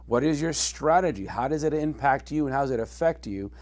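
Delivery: ordinary talk, nothing marked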